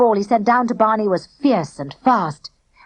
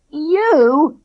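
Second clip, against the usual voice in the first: spluttering; 6 semitones higher, 4 dB louder than usual. A narrator's reading. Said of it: "spluttered Spag".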